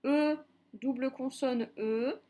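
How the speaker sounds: ordinary talk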